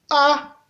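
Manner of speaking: A held level at mid pitch. Shown as a demonstration. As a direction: say A on an even middle tone. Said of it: "Ça donne donc ceci". (« A » au ton moyen)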